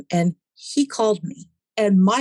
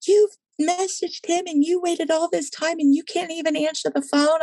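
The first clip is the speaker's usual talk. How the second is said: scolding mom voice, pitched way up; high exasperated mom; 10 semitones higher than usual. Retold or imitated. Mom scolding daughter for not answering, high exasperated. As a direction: scold like a fed-up mother. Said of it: "she goes".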